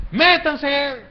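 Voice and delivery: loud, high bullying orders; overbearing guard shouting commands; despotic jailer. Acted. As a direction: order prisoners about roughly. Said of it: "son personas déspotas".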